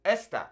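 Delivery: Esta with the accent on the first e, ES-ta; stress on first syllable. Shown as a demonstration. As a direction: hit the E of Esta, let the ending fall. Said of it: "there's a little accent on these first E's".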